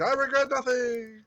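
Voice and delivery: high, drawn-out falling cry; mock defiant last words. Man dancing off a cliff, mock defiant last words. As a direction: stretch it out like a comic farewell.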